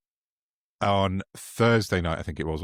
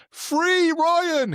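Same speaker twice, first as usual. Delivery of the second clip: much higher, louder mock rallying cry; mock shout; 5 dB louder than usual. Mocking the mate shouting for Ryan's release, as a high loud mock shout. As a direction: yell it like a loyal sidekick. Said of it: "he shouted at the end".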